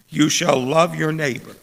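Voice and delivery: ordinary talk, nothing marked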